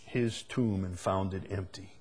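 hushed. Real, not acted. Preacher drops for solemn climax.

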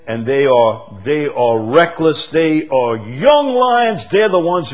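high, loud, slow preaching; preaching at full force. Real, not acted. Preacher exhorting the congregation about young lions, loud and slow. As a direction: lift the hall with it, slowly.

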